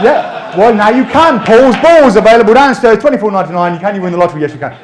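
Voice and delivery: loud, high hawker's plug; mock sales pitch. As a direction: call it out like a market seller. Mock sales plug over audience laughter.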